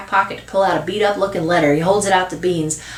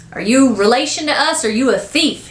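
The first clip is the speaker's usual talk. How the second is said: put-on pitched-up nosy kid voice; suspicious boy; 6 semitones higher than usual. Boy quizzing an unknown visitor, suspicious. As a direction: question her like a wary little boy.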